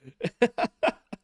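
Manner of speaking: amused laugh at himself; real laugh. Real, not acted. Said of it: "laughs at own accent".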